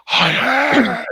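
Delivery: high guttural croak. Shown as a demonstration. Showing co-host what whispering isn't, guttural. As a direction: push it from the throat, the wrong way. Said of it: "it's not that guttural thing".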